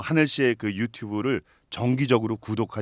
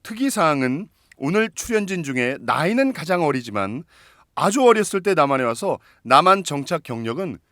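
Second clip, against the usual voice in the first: announcer voice; 4 semitones higher, 4 dB louder than usual. Acted. Host introduces a guest.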